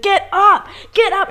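high, loud mock scream; retold screaming. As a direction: act out a desperate yell. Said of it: "screaming at him".

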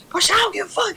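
high mock defiance; imitated yelling mom. Mom facing down her child, imitated angry yell. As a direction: throw it out high and heated as mom. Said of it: "really yelling".

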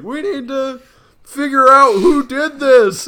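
high, loud panicky quote voice; Tim Robinson impression. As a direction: squeal it out in a high pitch. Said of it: "Tim Robinson in a hot dog costume going like".

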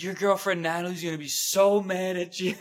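high, teasing tattletale voice; sing-song it like a meddling drunk girl; a drunk ex-girlfriend taunting Jared at the bar, high and teasing; mocking girl voice. Friend mimics menacing ex-girlfriend.

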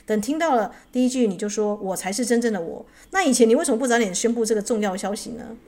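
loud, insistent narrated retort; firm. A narrator's reading. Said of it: "武士坚定地说". (坚定有力、提高音量地质问)

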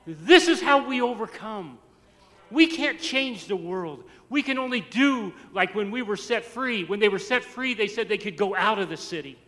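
ringing sermon climax; full-force preaching. Real, not acted. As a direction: drive each line home to the congregation.

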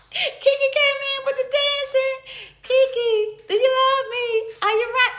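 high, excited fan talk; squealing to a viewer who came dancing; gushing hype. Real, not acted. Hyped greeting to viewer.

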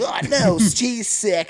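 high-pitched retold alarm; hammed-up realization; mock dismay. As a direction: exclaim it like a shocked viewer. Acting own reaction to film.

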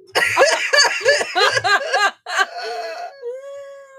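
loud high laughing fit; long helpless laugh. Real, not acted. Sister recalling her prank, loud high laughing fit. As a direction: break down laughing.

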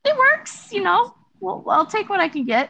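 high, tight amused voice; squeaky agreement. Acted. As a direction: squeak it out politely. Player voices character, squeaky.

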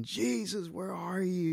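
high-pitched mock scream for help; retold scream. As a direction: cry out like panicked disciples, not at full volume. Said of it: "and they're screaming".

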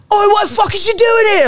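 very high mock-Manchester exclamation; Manchester accent. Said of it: "he grew up in Manchester".

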